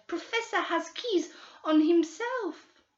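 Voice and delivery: put-on high old woman with a Portuguese accent; Portuguese-accented old woman refusing flatly; Brazilian housekeeper. Old woman stonewalling the detectives in a Portuguese accent.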